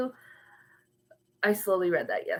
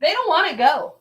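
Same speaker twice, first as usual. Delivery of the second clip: 1 exasperated; 7 semitones higher, 5 dB louder than usual. Real, not acted. Exasperated at dogs.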